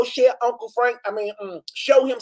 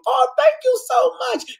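overjoyed squeal. Real, not acted. Touched by a viewer's praise.

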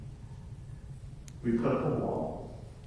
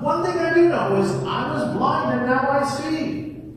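high, loud and insistent; healed man defending himself loudly; emphatic declaration; 12 semitones higher, 8 dB louder than usual. Acted. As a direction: proclaim it boldly. Healed man insists on his testimony.

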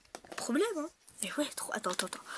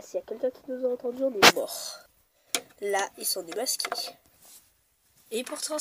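usual speaking voice

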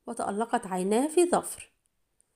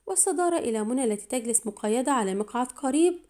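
ordinary delivery

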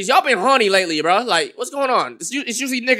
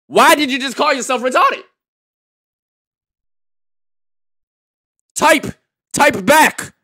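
excited demand; 7 semitones higher, 5 dB louder, slower than usual. Real, not acted. Urging chat to reply.

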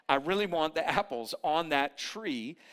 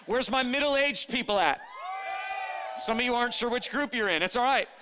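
playful teasing; 6 semitones higher than usual. Real, not acted. Teasing the congregation loudly.

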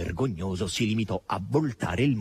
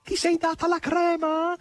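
high, excited question thrown across the air; excited shouted question; excited high call. A narrator's reading. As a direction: call out in wonder. Margherita calls to Natascia in flight.

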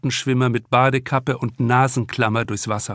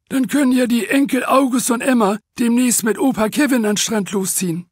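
much higher joking character voice; 10 semitones higher than usual. Acted. Piet joking to Antje about grandpa Kevin, higher amused character voice. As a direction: spin the joke further, amused.

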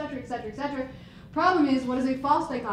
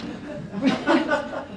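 embarrassed laugh; 5 semitones higher, 3 dB louder than usual. Real, not acted. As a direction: laugh at your own slip. Laughs at pressing wrong button.

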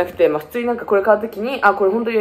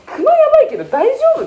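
imitating worried friend; 4 dB louder than usual. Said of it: "とか言われたから".

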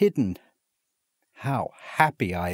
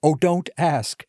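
higher, louder, bursting with news; excited; 8 semitones higher, 5 dB louder than usual. A narrator's reading. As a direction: blurt it out overjoyed. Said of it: "with excited face".

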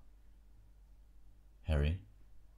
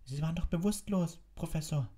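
put-on high-pitched, decided girl; firm; 12 semitones higher than usual. A narrator's reading. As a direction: contradict the teacher firmly. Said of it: "sagte Hermine entschieden". (entschieden, mit hoher Mädchenstimme)

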